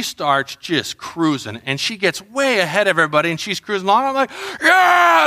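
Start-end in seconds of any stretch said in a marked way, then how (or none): 4.6-5.2 high, loud, drawn-out whoop; screaming cheer. Retold or imitated. Parent filming a daughter's race, screaming cheer. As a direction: scream it from the bleachers. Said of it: "hear my voice just screaming".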